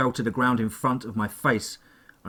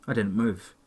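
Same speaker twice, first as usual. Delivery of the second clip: plain reading voice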